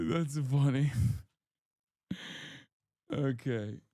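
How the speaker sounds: usual speaking voice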